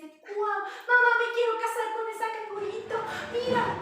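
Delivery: high, loud and fast, bursting with excitement; smitten young kangaroo. Retold or imitated. Young kangaroo telling mama about the perfect girl, thrilled. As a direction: blurt it out giddy with new love. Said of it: "se enamora de ella y dice".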